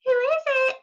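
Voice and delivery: wolf sweetly faking Granny; high sugary granny voice; sweetest Granny voice. Acted. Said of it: "in his sweetest Granny voice".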